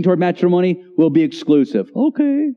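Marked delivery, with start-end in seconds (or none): 1.9-2.5 high falsetto reply; mock a sweetheart in falsetto; put-on high girlish voice; girlfriend's voice. Acting the woman's replies, falsetto.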